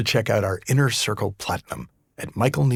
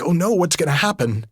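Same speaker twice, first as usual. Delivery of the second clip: mock alarm; 8 semitones higher, 5 dB louder than usual. Acting out a viewer's alarmed thought.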